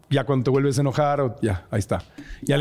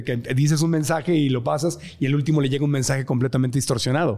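ordinary talk, nothing marked

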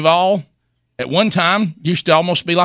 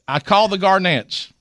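normal talking voice

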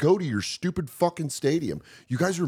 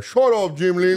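louder, higher mock retort; mock outburst; 4 semitones higher, 6 dB louder than usual. Cabrera telling Jim off, mock outburst. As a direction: cut someone off with a raised voice.

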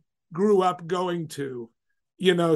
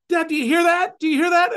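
put-on excited kids; 10 semitones higher, 5 dB louder than usual. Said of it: "the kids are like".

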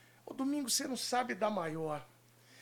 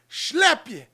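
whoosh noise; 6 semitones higher, 12 dB louder than usual. Acted. Sound effect for camera cut.

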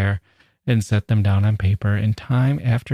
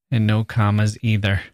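normal talking voice

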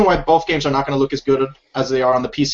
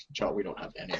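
whispered aside; 7 semitones lower, 16 dB quieter, faster than usual. Pretend.

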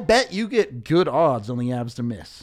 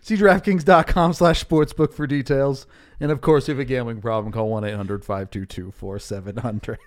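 rattled-off sportsbook disclaimer, chuckling at first; legal disclaimer read; 4 dB louder than usual. Acted. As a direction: read the fine print like an ad. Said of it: "The DraftKings read".

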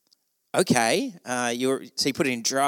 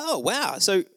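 overly polite; 6 semitones higher than usual. Retold or imitated. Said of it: "in a more polite way".

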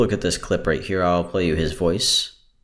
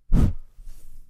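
breath blown into mic. Shown as a demonstration. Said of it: "even if I use my shirt".

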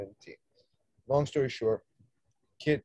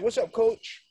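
casual player greeting; 12 semitones higher, 5 dB louder, slower than usual. Retold or imitated.

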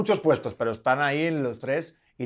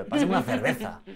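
put-on mother's Castilian accent, lisped Z in cerveza, said lightly; İspanyol aksanı; 3 semitones lower, 3 dB quieter than usual. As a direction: imitate mom's Madrid accent with a soft th. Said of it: "mi madre habla con la Z".

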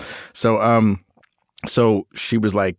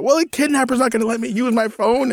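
put-on much higher, whining teenage daughter; whiny teen outburst. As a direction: throw a bratty teen tantrum at dad. Daughter voiced in argument.

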